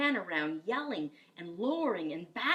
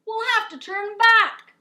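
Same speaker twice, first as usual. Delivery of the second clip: high, loud pirate call; pirate shouting over the storm; 14 semitones higher, 15 dB louder than usual. Acted. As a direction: call it across the deck. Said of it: "called another".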